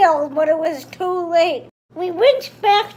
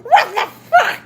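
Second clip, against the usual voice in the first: high, loud shocked shout; laughing shocked shout; 8 semitones higher, 8 dB louder than usual. Retold or imitated. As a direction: yell it out in shock. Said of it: "I shouted".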